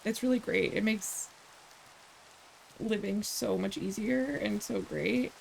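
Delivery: understated ironic praise; sarcastic. Real, not acted. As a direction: say it dryly, as mock enthusiasm. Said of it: "I'm being sarcastic for those who don't".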